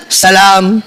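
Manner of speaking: low, unenthusiastic one-word salam; expressionless husband. Retold or imitated. Husband returning his excited wife's greeting, deadpan and low. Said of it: "Mukanya begitu".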